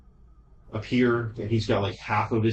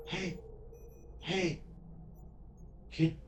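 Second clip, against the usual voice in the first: put-on little girl voice; 8 semitones higher than usual. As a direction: call out gently like a lost little girl. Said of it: "which you hear a little girl's voice".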